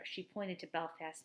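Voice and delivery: plain reading voice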